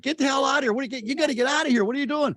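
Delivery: the brain freaks out in the cold; panicked brain. Retold or imitated. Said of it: "it's like".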